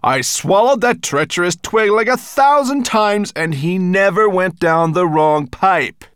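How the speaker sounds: high, loud angry dragon; furious. Acted. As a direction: keep roaring, furious. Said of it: "Nettlebrand's furious voice".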